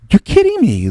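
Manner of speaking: gasp, then high-pitched astonishment; shocked disbelief. Retold or imitated. Son hearing father's story of Jim Brown, shocked. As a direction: act out a gullible kid's shock.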